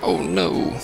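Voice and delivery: normal talking voice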